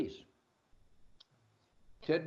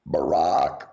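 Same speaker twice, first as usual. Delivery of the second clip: low, loud monotone croak; gag croak; 13 semitones lower, 4 dB louder than usual. Pretend. Host drops a name as a gag, croaked. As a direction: croak it out on one deep note.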